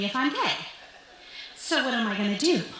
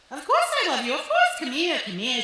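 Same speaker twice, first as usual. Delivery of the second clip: reassuring mom; 5 semitones higher than usual. Retold or imitated. Mom reassures her daughter.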